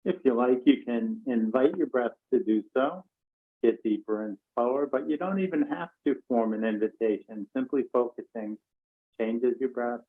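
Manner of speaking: calm meditation voice with long pauses; guided meditation. Real, not acted. Teacher leading an online class to notice their breath, calm and spacious. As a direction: guide them gently into noticing the breath, unhurried.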